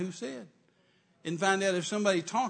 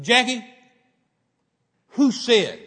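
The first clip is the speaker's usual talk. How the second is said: sudden loud, higher-pitched demand; retold anger; 6 semitones higher, 13 dB louder, slower than usual. Man confronting his sobbing fiancée, retold angry outburst. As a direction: re-enact an outraged challenge at full volume. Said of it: "It just made me so angry".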